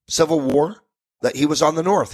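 ordinary delivery

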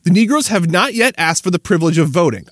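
ordinary talk, nothing marked

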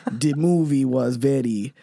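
put-on low, halting Russian accent; put-on Russian accent, amused; mock Russian accent. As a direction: play it Russian, deep and deliberate. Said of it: "Do, like, a Russian accent".

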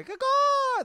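loud, very high bird-like caw; mock Australian call. Summoning other Australians like a bat signal, mock call. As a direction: let out a high, whooping call. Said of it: "it's like the Australian call".

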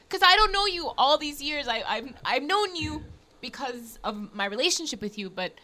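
normal talking voice

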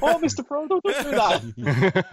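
high, slowed, laughing Samwise copy. Retold or imitated. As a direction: imitate the Samwise line, dragging it out. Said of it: "That sounds like".

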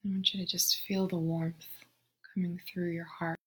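soft, unhurried meditation voice with long pauses; guided meditation. Real, not acted. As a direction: guide them gently into the breath, hushed and spacious. Leads a breathing meditation.

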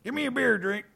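high demanding voice; silly saloon customer. Acted. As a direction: order a beer like a goofy cowboy. High put-on bar voice.

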